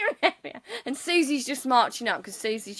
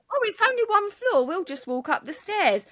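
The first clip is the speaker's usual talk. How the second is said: breezy optimism; 8 semitones higher than usual. Retold or imitated. Retelling the hotel stairs to friend, mock breezy. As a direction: start high, settle down, mock-casual. Acting out own naive optimism.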